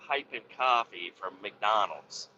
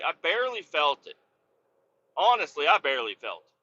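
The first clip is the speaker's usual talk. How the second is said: ordinary delivery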